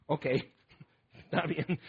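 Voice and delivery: soft, laughing give-in; playfully imitating a listener giving in with a laugh; laughing reluctant concession. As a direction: shrug it off with a little laugh. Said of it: "Y dices".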